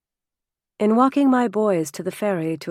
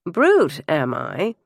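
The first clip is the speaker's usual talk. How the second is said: arch; 3 dB louder, slower than usual. A narrator's reading. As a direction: toss it back archly, feigning offence.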